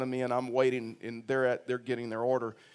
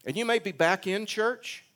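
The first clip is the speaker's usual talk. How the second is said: normal talking voice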